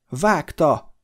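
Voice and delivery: loud urgent cry; shout. A narrator's reading. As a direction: yell the command to gallop. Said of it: "elkiáltotta magát". (kiáltás)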